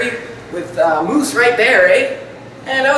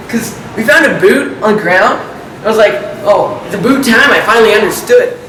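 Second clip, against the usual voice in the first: put-on Canadian accent with tacked-on "eh"; hammed-up Canadian accent. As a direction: play a caricature Canadian, "eh" after every thought. Parody Canadian anecdote about a boot.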